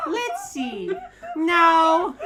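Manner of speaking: very high squealed mock shout; hammed-up shout. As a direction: shoot up high, then blast the refusal. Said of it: "number three shouted".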